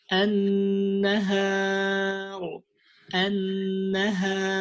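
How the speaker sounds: an-nahar with a light, clear ha; correct light ha. Shown as a demonstration. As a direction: say an-nahar slowly, ha kept light. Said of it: "So don't make the ha heavy".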